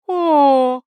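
loud, high, stretched-out oh; drawn-out delighted oh. A narrator's reading. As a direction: let the oh ring out in delight. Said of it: "протянули обе волшебницы".

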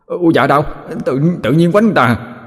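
high, mild cry of protest; yelping complaint. Acted. Demon protests being hit on head, yelping. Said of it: "Thằng chả la bài hải lên".

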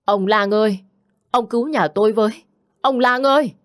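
high desperate shout, voice near tears; frantic husband wailing at the gate for help; wailing cry for help. A narrator's reading. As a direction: scream for the doctor as if about to break down. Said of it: "gào lên như mếu".

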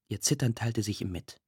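usual speaking voice

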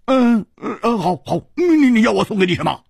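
high, rushed, stuttering question; agitated and impatient, pitch way up; flustered stammer. A narrator's reading. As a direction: trip over yourself agreeing. Flustered man asks the price.

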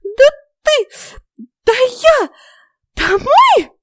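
very high, loud fairy outbursts; enraged tiny fairy at bursting point; spluttering indignation. A narrator's reading. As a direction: splutter in separate furious squeaks. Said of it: "задрожал от рвущегося наружу негодования".

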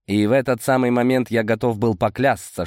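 ordinary delivery, nothing marked

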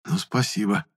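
hushed, biting thanks; sarcastic whisper. A narrator's reading. Boy to woman who betrayed him, sarcastic. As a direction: say thanks quietly with sting. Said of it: "шёпотом, но всё равно язвительно сказал я".

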